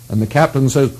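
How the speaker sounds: usual speaking voice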